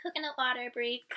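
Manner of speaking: ordinary talk, nothing marked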